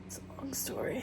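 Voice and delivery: hushed secretive whisper. Pretend. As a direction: breathe it out like a juicy secret. Mock secretive aside.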